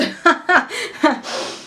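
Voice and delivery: high, acted laugh at own joke; nervous interview laugh. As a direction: laugh too keenly to impress the interviewer.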